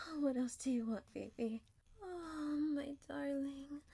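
ordinary talk, nothing marked

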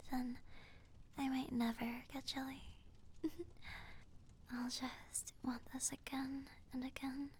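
teasing affectionate whisper; playful whisper. Pretend. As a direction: whisper it with a grin.

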